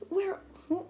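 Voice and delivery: acted bewildered demand; baffled questioning. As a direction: stumble over the question as a stunned onlooker. Baffled onlookers confront the villain.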